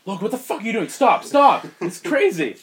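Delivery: high, loud, panicked pleading; frantic mock shouting. Heather begging Logan to stop, frantic acted shout. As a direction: yell to stop it, acting alarmed.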